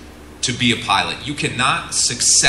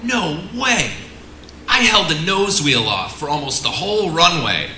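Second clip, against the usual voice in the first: mock protest, pitched up high and pushed louder; indignant pilot, high and louder; 7 semitones higher, 3 dB louder than usual. Student pilot defending his landing to the instructor, high indignant protest. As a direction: play the proud student insisting he landed fine, high and loud. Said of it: "And they go".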